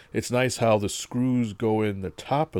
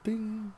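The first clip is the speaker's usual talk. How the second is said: high ding sound; 13 semitones higher, 5 dB quieter than usual. Retold or imitated. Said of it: "Is there a sound effect for mail?".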